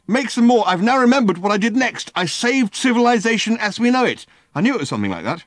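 high, loud, manic friend; a wired alien, high and loud; excitable Ford. Acted. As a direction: bark orders in a giddy frenzy. Ford's voice held in story.

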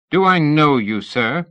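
captain to Fraser, a jolly greeting hiding murder; brittle murderous jollity. A narrator's reading. Captain questions Fraser with brittle, murderous jollity. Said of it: "with a brittle, murderous jollity".

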